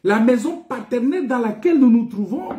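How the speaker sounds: ordinary talk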